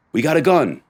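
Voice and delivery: a loud, high cop's shout across the lot; calling out. Retold or imitated. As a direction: shout it out like a cop who just found the gun. Cops calling out during vehicle search.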